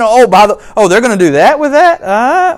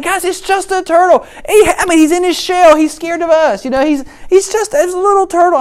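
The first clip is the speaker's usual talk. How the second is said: much higher soothing plea; calm them down kindly; pleading reassurance; 10 semitones higher than usual. Retold or imitated. Dad calming family, high.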